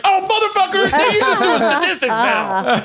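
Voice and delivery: very high, laughing mock fury; imitated outraged outburst. Donnell accusing the psychic, high imitated outrage. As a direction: blurt it high, then stretch it out. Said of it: "And he's like".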